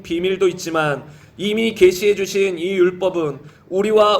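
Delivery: low, even, measured read-aloud; scripture reading. Real, not acted. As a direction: read the verses in a low, steady chant-like cadence. Reads scripture aloud to congregation.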